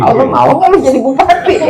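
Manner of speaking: high, loud, laughing jab; laughing tease. Acted. Teacher mocking a candidate for regent, laughing.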